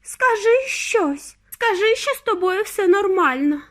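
high, urgent begging; desperate drawn-out high plea; pleading mother. A narrator's reading. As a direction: beg for an answer in a high voice. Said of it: "благала пані Т В".

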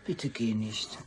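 quiet, low pleading; soft plea. Acted. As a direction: beg him softly to stay. Begging him to stay.

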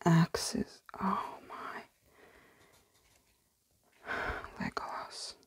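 fading to a barely audible whisper; awed whisper. Real, not acted. Finding Legolas in the book, awed whisper. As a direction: breathe the words out, almost silent. Hushed awe over pages.

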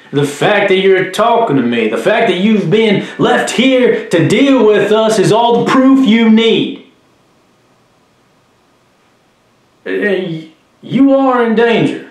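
high, loud, emphatic pressing; forceful insistence. Acted. Officer pressing a suspect about betrayal.

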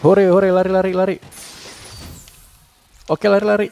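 gleeful run-for-it cheer; excited run. Real, not acted. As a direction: urge the party to flee. Fleeing the guard, cheering.

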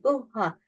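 "uhha" with no breath flowing on the h; quick Turkish-style "uhha"; clipped wrong h. Shown as a demonstration. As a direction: cut "uhha" into short plain syllables. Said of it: "Bunlar olmaz arkadaşlar".

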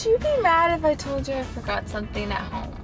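ordinary talk

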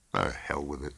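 low, muted dismissal; inner thought. A narrator's reading. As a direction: dismiss it quietly to yourself. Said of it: "thought Robert Wilson".

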